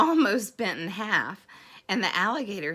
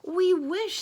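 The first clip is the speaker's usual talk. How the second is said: high, bright little creature; high fish voice; 10 semitones higher, 4 dB louder than usual. A narrator's reading. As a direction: pipe it up like a small fish. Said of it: "said the fish".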